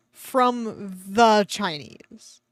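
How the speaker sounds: slow, drawn-out From the Chinese, leaning on the; mock the odd phrasing, stretch every word; weird stressed the. Shown as a demonstration. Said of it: "Very weird".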